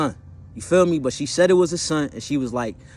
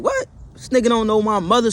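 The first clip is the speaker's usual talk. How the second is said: higher, louder shocked outburst; stunned disbelief; 9 semitones higher, 4 dB louder than usual. Retold or imitated. Parents in car shocked by toddler, loud disbelief. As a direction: blurt it out in amazement.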